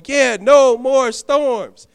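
slow, high, loud shout; mock celebration. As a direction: belt out a pretend cheer of relief. Imagined shout of relief.

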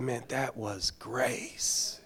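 slow, soft, gentle voice from God; soft voice of the Lord. Retold or imitated. The Lord speaking gently to the pastor, soft and slow. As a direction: let it land softly and slowly. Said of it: "The Lord spoke to me and said".

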